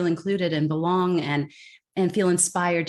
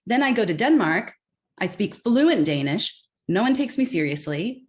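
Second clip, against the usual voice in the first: usual speaking voice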